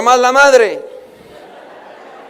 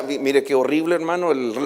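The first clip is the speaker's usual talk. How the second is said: ordinary talk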